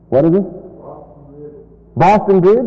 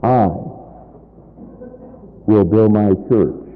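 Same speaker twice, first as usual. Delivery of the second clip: "I" set apart by a long pause, rest lower; stress on I; 5 semitones lower, 4 dB quieter than usual. Shown as a demonstration. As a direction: let "I" stand alone before the rest. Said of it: "putting the emphasis on a different word".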